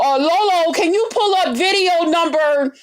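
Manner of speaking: high, mocking voice of nosy fans; sarcastic impression of chat asking to pull up a video; mocking nosy viewers. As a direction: parody the viewers who demand the exact timestamp. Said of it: "people gotta go".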